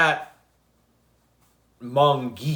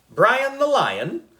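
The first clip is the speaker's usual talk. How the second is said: chorused delight; 12 semitones higher, 4 dB louder, slower than usual. A narrator's reading. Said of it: "we all said".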